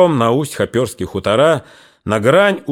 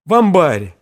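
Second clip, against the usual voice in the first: higher voice, halting and tearful; shaken tearful witness; 7 semitones higher, 3 dB louder than usual. A narrator's reading. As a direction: blurt it out through tears. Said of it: "коротко, сбивчиво".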